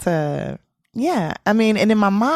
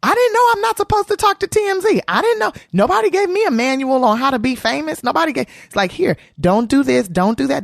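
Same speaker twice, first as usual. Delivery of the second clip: high-pitched exasperated defence; indignant protest; 7 semitones higher, 3 dB louder than usual. Retold or imitated. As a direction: act out pleading ignorance indignantly. Acted quarrel, defending herself.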